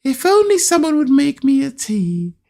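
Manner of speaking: high-pitched imitation of a partner; wistful hinting. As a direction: drop a mock-wistful hint, pitched high. Retold partner hinting for tea.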